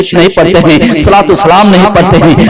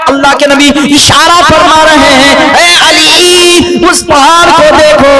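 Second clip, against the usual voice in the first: raised, sing-song preaching of the story; 7 semitones higher than usual. Acted. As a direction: preach the tale to the gathering.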